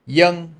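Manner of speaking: put-on "young" said high and loud with a Y sound for V; Southern Vietnamese name demo. Shown as a demonstration. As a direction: model "young" for students to copy. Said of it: "spelled with a V".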